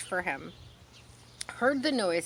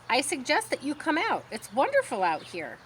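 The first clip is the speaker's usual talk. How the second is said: lightly raised goose voice; a brisk, eager goose coaxing the pig; hint of a fussy goose; chatty goose; 6 semitones higher than usual. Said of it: "she talks fast and she kind of repeats herself".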